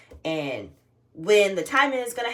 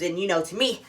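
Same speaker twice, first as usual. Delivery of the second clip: ordinary delivery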